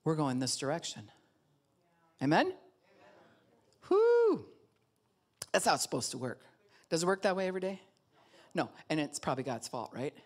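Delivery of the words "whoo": high, loud celebratory whoop; preacher whooping with joy; excited whoop. Real, not acted. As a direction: cheer the congregation on. Sermon celebration shout.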